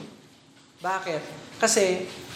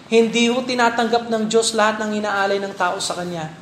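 ordinary talk

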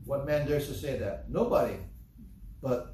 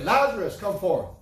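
loud, higher command to Lazarus; commanding call; 5 semitones higher, 6 dB louder than usual. Acted. Jesus calling Lazarus from the tomb: loud command.

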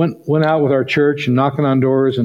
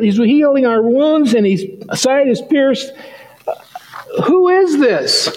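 much higher, eager pleading questions, building; excited urging; 11 semitones higher than usual. Retold or imitated. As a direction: press him eagerly to see it.

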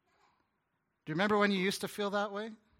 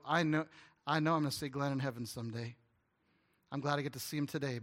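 ordinary delivery

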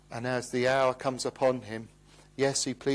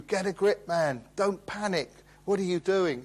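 higher, barking drill instructor; military instructor shouting; 5 semitones higher than usual. Retold or imitated. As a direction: bark at a panicking recruit. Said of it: "military instructors would, would shout at you".

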